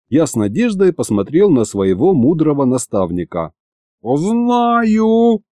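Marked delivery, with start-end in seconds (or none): 4.0-5.4 high, stretched cry of eureka; sudden yell. A narrator's reading. Aristofan suddenly has an idea, high excited yell. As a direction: burst out high, holding the word long. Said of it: "завопил вдруг Аристофан".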